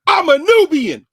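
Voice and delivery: slow, high, loud proud claim; proud declaration. Retold or imitated. Big brother proudly announcing himself to the speaker. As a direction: announce it slowly with pride.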